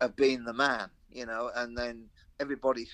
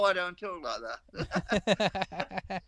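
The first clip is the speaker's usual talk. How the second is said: laughing; 7 semitones higher, much slower than usual. Real, not acted. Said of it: "laughing denial".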